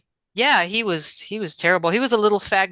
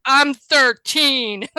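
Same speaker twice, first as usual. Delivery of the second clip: mock bully sneer; 6 semitones higher, 5 dB louder, much slower than usual. Bully sneering at a seven-year-old.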